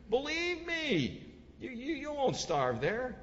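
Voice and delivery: high, drawn-out mock assurance; acted coaxing promise. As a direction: play the enemy's persuasive pitch. Enemy commander coaxing the people.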